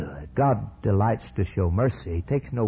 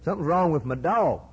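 higher-pitched retold boy; worried boy; 7 semitones higher than usual. Boy tells his dad about his dog, lightly voiced worried boy. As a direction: lightly voice a child reporting trouble. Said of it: "Ralph came in and he said".

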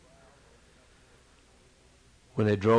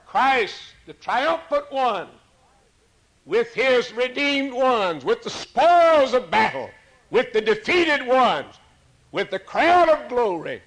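high, loud triumphant build; preaching at full force; 11 semitones higher, 7 dB louder, slower than usual. Acted. Preacher crying out Christ's triumph to the congregation, full force. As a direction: lift the crowd to the crown of glory.

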